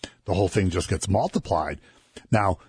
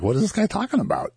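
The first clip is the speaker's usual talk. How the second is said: high, quick incredulous question; mock bewilderment, high and rushed; baffled listener; 13 semitones higher, faster than usual. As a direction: pitch it way up, puzzled and quick.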